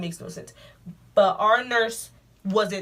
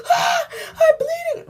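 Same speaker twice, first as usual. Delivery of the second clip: high, drawn-out sobbing wail; imitating her younger self crying out in shock; crying panic; 8 dB louder, much slower than usual. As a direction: wail it through tears in a panic. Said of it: "And then I start crying".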